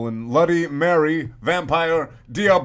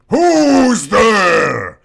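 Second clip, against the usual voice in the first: much higher and louder janitor shout; angry shout; 8 semitones higher, 11 dB louder than usual. Acted. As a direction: bark into the dark at intruders. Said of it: "he shouted angrily".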